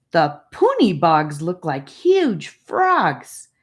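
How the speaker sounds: slow and loud, full of wonder; a boy bragging about his adventure, slow and loud; animated. A narrator's reading. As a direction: tell it like a thrilling discovery. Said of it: "telling them with animation".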